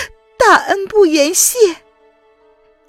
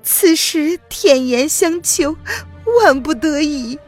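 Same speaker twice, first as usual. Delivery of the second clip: high, loud, choked pleading; humble yourself through tears; desperate tearful plea; 11 semitones higher, 3 dB louder than usual. Acted. Woman humbly begging old rival physician, high and tearful.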